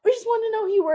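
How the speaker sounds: squeaky overeager gate agent asking who she was; mock gate agent. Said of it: "And they're like".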